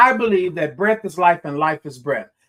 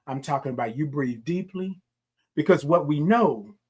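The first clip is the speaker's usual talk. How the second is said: usual speaking voice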